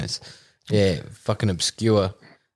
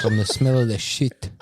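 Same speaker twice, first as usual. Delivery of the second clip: hint of a South African accent; clipped Kiwi-tinged South African voice; 2 semitones higher than usual. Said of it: "Is that your best South African accent?".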